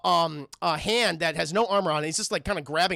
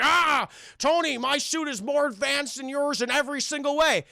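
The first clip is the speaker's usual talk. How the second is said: villain gloat; 6 semitones higher than usual. Retold or imitated. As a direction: gloat like a smug armored villain.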